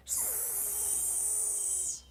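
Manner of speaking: quick unvoiced hiss; snake hiss. Boy showing his brother the hiss. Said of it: "it was me going".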